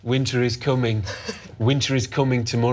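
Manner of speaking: put-on low, northern English accent like a brooding hero; a hint of a northern English lord, low and heavy. To the hosts, doing a grim northern English accent. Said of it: "You sound like [name]".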